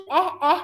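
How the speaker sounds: แอะ said as the short sound ɛ; letter sound of A. Shown as a demonstration. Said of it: "มันไม่ได้ชื่อว่า A แต่มันน่ะชื่อ". (ออกเสียง แอะ สั้นๆ แบบเสียงของตัว A)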